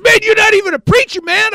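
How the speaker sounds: high, loud protest; acted shocked challenge. Shocked men challenging the preacher, loud protest. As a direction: call him out in a high, disbelieving yell.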